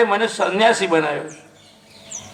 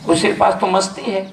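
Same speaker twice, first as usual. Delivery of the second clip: ordinary delivery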